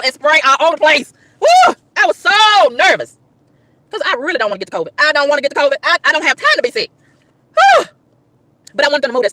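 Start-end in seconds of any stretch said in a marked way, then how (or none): 1.4-1.8 high, loud whoop of stress; exasperated woo. Real, not acted. Venting about maskless movers, stressed woo. Nervous about getting sick. 7.6-7.9 high, loud whew; stressed whew. Real, not acted. Venting about fear of covid, stressed whew.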